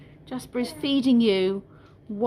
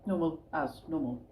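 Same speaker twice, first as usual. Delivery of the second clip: softer, muttered repeat of her own phrase; amused quiet echo; 7 semitones lower, 10 dB quieter than usual. Real, not acted. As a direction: savour the odd phrase under her breath. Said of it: "as normal as normal".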